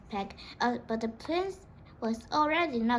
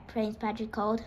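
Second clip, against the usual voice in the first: usual speaking voice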